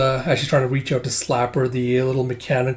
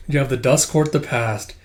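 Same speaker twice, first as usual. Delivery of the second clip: usual speaking voice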